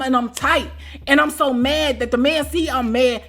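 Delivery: ordinary talk, nothing marked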